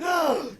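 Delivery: short, high theatrical gasp; hammed-up sharp intake of breath; shocked gasp. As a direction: gasp in mock outrage. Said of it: "I went".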